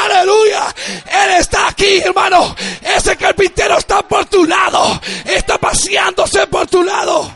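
loud, high, ringing preacher cadence; fervent pastor proclaiming the carpenter passing by; preaching at full force. Acted. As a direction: drive each line home to the congregation. Preaching to the congregation.

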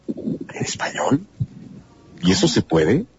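slow, breathy whisper of disbelief; as if hearing something impossible; incredulous question. Retold or imitated. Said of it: "Y dicen". (susurro lento e incrédulo)

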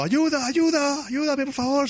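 very high, slow mock plea; hammed-up old man calling for help; mock cry for help. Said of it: "se oye un hombre gritando". (grito de auxilio exagerado, muy agudo)

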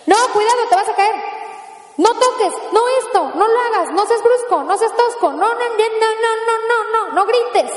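nagging parent. Acted. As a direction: fire off warnings at a toddler.

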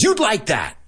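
high, mocking take on YOUD; sarcastic retort. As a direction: throw it back at him, high. Teacher acts Pony Boy's retort.

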